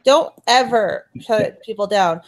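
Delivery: ordinary talk, nothing marked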